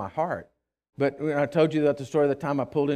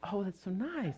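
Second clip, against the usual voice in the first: much softer, forced nice; fake sweetness; 4 semitones higher, 13 dB quieter than usual. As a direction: paste on a polite tone. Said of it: "quickly changed my face and says".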